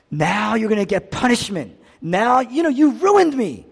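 mock angry scolding, loud and high; imagined rebuke. Preacher voices what Jesus never said to deserters, mock rebuke. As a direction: raise the voice in a pretend accusation.

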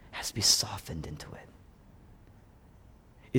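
low, slow whisper. Real, not acted. Preacher whispering about a softened heart. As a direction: soften it to nothing.

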